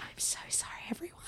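apologizing to the group in a whisper; whispered apology. Retold or imitated. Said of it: "And I was like".

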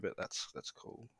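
unvoiced, slow, resigned aside; whispered. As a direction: breathe it out, pretending it's fine. Faking being fine.

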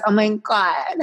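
high camp squeal; a high, hammed-up camp gush; mock camp gush. Mocking camp voice.

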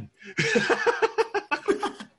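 squealing high laugh; high burst of laughter. Real, not acted. Breaks up laughing.

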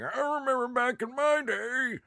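very high, creaky mock-geezer voice; hammed-up old-timer reminiscing way up high; old man. Podcaster mocking himself for sounding old, hammed-up old man. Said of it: "I sound like an old man now".

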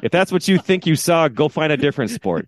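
high-pitched indignant tirade; heated re-enacted coach rant; berating the umpire. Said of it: "still yelling at this umpire".

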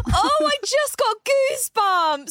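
high startled gasp and exclaim; gasp, then blurt out in shock. Real, not acted. Reacting to creepy story.

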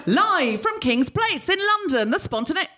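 high, slow showbiz announcement; announcer intro. Shown as a demonstration. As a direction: announce it slowly like a live show opener. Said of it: "I didn't say it properly that time".

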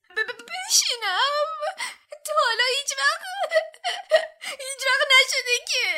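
high, loud, stammering sob of a house-elf; wailing elf. A narrator's reading. Dobby wails to Harry, stunned at being asked to sit, sobbing. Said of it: "دابی با گریه و زاری گفت".

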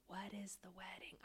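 hushed, near-voiceless aside; puzzled whisper. Retold or imitated. Quoting own reaction to the tour guide, puzzled whisper. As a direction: whisper it as a baffled private thought.

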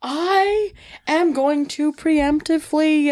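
drawn-out announcement. Real, not acted. Player announcing a preemptive move, drawn-out. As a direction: stretch each word out theatrically.

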